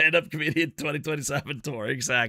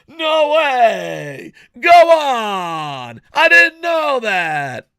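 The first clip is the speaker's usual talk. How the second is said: much higher, shouted fake awe, slowing at the end; mock amazement; 7 semitones higher, 6 dB louder, much slower than usual. To chat, acting out how he fakes agreement, shouted. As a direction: overplay being amazed. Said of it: "So you just go".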